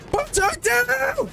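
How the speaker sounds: "Put it down." screamed very high and drawn out slowly, stress on down; full frantic shriek at the teammate; screaming fit. Real, not acted. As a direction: peak of a real panicked scream. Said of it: "that little screaming fit".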